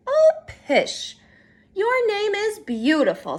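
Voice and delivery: high, loud reassuring scoff; dismissive comfort. Acted. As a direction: brush off her worry fondly. Mother dismisses worry, comforts.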